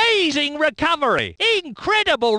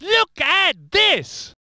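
high, drawn-out cry; slow, emphatic commentator shout; amazed exclamation; 4 semitones higher, much slower than usual. Acted. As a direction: stretch each word in astonishment. Commentator exclaims, stretched take.

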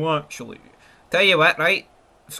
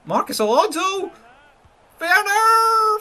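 alarmed call; 3 dB louder than usual. Real, not acted. Commenting as the opponent attacks.

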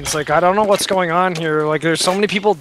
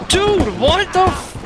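shocked; 11 semitones higher, slower than usual. Real, not acted. Shocked at barbed wire.

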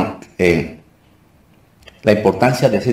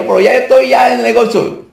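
high put-on voice, far above your own; mimicked callers; 4 dB louder than usual. A client reporting to Roger about business, high-pitched imitation. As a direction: imitate a prospering client showing off. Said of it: "Me dicen".

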